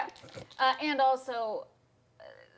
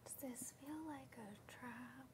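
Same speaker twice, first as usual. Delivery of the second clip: whispering; 17 dB quieter than usual. Acted. Player whispering to the party about a possible trap, hushed. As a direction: whisper it warily to your companions.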